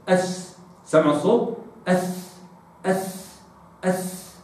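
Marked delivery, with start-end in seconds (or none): 1.9-4.3 أث breathed softly, fading each time; repeated th drill. Shown as a demonstration. Teacher asking if they hear it, soft أث. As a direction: hiss أث three times, softer each time. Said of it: "هكذا".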